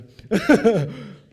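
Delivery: big burst of real laughter; loud laugh. Real, not acted. Laughs after mock insult.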